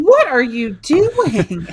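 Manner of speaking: slow, high, disbelieving; incredulous. Real, not acted. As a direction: drag it out in disbelief. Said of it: "gone off the rails".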